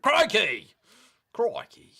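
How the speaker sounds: high, punched Aussie catchphrase; Steve Irwin. Acted. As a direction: exclaim it like an excitable Australian. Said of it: "What was the Steve Irwin one?".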